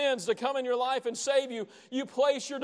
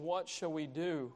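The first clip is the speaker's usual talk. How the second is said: hushed anguished plea; 6 semitones lower, 8 dB quieter than usual. Acted. Crowd convicted by Peter asks him softly, hushed. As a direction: lower and much softer, a broken question. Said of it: "they cry out under such conviction".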